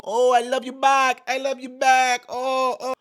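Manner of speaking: high, loud gushing parody voice; Kamala impression. Parodying Kamala gushing at a crowd, high and loud. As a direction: gush it in a mock politician's voice. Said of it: "cackling Kamala Harris".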